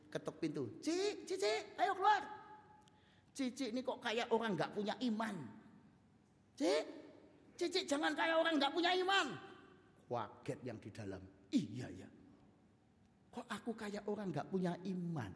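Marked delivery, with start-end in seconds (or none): 0.8-9.3 calling through the door. Acted. New student calls through door. 11.5-15.3 quiet realization. Acted. Girl answers quietly from inside.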